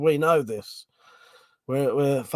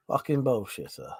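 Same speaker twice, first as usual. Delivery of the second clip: ordinary talk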